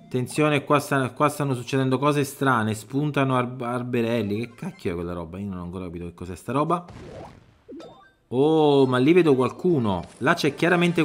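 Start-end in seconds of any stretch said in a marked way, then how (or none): 4.4-6.8 puzzled mutter. Real, not acted. Puzzled by strange objects.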